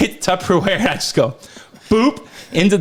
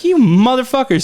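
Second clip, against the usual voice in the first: mock exasperated retort; 6 semitones higher, 4 dB louder than usual.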